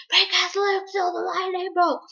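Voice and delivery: high, loud, outraged explanation; indignant outburst. Retold or imitated. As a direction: blurt it out like a wronged teen. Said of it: "It's like".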